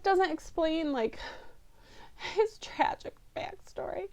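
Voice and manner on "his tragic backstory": a hushed whisper for the sad part; dramatic whisper. Real, not acted. Reviewer telling viewers what the movie cut, a dramatic whisper. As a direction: lean in and breathe it. Dramatic aside about Poe.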